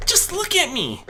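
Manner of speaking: high, loud harsh cry of a small dragon; young dragon snapping back in pain; harsh outburst. A narrator's reading. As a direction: throw the hurt at him. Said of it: "Spike spoke out harshly".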